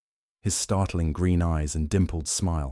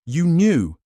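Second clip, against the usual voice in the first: much higher, loud accusation; furious snarl; 11 semitones higher, 8 dB louder than usual. A narrator's reading. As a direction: spit it at him in outrage. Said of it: "And I snarled".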